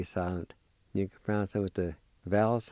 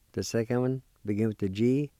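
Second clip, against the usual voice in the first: ordinary talk, nothing marked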